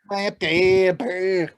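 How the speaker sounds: deliberately bad French accent; mangled French pronunciation. Shown as a demonstration. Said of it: "It's just bad".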